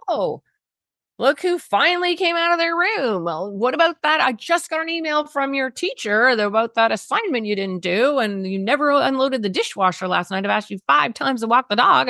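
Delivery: high, sarcastic "look who finally came out"; sarcastic parent nag. Retold or imitated. Parent greeting the teen with sarcasm, then complaints. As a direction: needle the teen like a fed-up parent. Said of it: "we tend to respond like".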